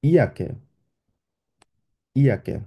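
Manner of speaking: ইয়াকে said as German "Jacke"; German word. Shown as a demonstration. Teacher giving a German example word. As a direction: demonstrate ইয়াকে with a clear Y sound. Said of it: "For example".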